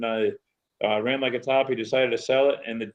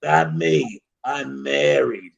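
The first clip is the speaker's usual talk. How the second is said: slow, dry, unbothered reply; calm deadpan; 4 dB louder, much slower than usual. Retold or imitated. Old lady answers Earl's come-on, calm and slow. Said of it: "all calm, and goes".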